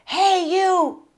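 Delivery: high, loud yell imitating the man; retold yell. Retelling a stranger yelling from across the street, loud imitated yell. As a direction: call out sharply as the heckler did. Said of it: "and a guy yelled".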